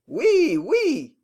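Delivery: high, swooping replies; excited high-pitched agreement; eager yeses. A narrator's reading. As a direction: answer in a fluster, voice leaping up. Servant answers excited master's questions.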